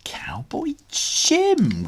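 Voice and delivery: high, slow, breathy whoop. A narrator's reading. Connor whoops at his dad's message. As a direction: realise the idea with delight. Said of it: "Connor whooped".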